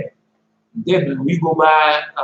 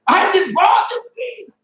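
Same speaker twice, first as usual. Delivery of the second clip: high, slow scolding shout; mom scolding; 14 semitones higher, slower than usual. Retold or imitated. Mother yelling at her kids over ripped jeans, shouting. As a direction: yell like an angry mom over torn jeans.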